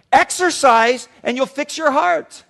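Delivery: firm doctor. Retold or imitated. Doctor's slow firm reply.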